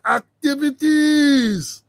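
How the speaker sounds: ACTIVITIES drawn out long, with a loud swell; stressed syllables. Real, not acted. Host saying what may be going on there, syllables heavily stressed. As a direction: stretch the syllables of "activities" for heavy emphasis. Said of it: "I got to stress on some of these syllables".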